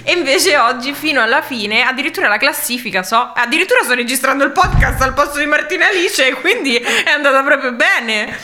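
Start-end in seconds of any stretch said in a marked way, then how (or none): 3.3-8.3 laughing. Real, not acted. Jokes about replacing the hosts.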